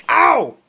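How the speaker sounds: short high yelp; sudden yelp of pain. Real, not acted. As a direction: yelp as if hurt.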